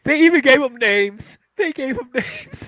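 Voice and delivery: high, laughing disbelief; incredulous laughing. Real, not acted. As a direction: laugh through the words in disbelief. Incredulous about named machines.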